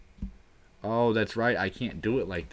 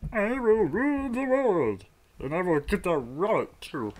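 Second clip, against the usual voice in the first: high villain voice, gloating; megalomaniac rant; 10 semitones higher, 4 dB louder than usual. Pretend. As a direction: proclaim it like a cartoon villain. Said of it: "let me try that again".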